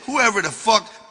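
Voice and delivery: ordinary delivery, nothing marked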